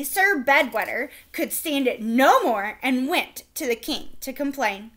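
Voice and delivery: plain reading voice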